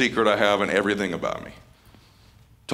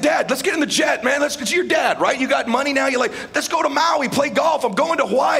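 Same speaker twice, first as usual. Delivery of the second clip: shouted, much higher, eager pitch; excited pleading; 3 dB louder than usual. Retold or imitated. Son urging his dad to take the jet to Maui, loud and excited. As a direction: sell him on the trip at full volume.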